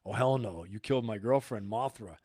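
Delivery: low, angry monster growl, soft at first; voicing Godzilla. Godzilla mourning Mothra, voiced low and angry. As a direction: drop it low and seething, like a furious beast. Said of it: "He was like".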